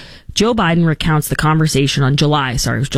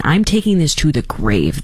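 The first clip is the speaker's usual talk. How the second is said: usual speaking voice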